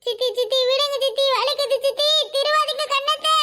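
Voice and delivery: put-on pained, whining child's plea; crying plea. Child begging aunt to let go, crying. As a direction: beg tearfully, stretching the last words. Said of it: "அழற சத்தம் கேக்குது".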